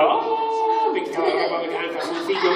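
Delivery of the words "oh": high jungle call; Tarzan yell. Retold or imitated. Boy coming home from school, yelling like Tarzan. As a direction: let out a high, loud Tarzan cry. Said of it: "hacía".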